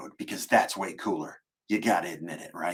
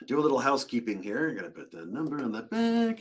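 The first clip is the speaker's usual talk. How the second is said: usual speaking voice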